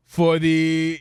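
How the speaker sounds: usual speaking voice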